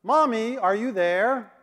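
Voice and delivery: put-on young son calling for his mommy at night; little boy calling out. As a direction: call for mommy like a little boy in a dark sleeping car. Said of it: "a voice came out of the darkness".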